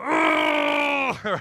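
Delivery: high strained shout-groan; pained groan. Retold or imitated. Preacher imitating his kidney stone agony. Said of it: "all I could do was go".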